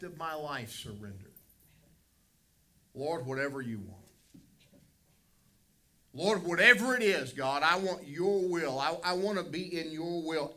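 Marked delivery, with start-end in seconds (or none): 2.9-10.5 loud, higher, fervent plea of surrender; preacher shows a desperate prayer, loud and high; impassioned prayer. Acted. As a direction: cry out to God, giving everything up.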